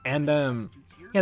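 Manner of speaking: ordinary delivery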